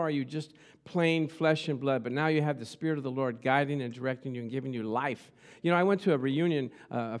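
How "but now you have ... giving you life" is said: ordinary talk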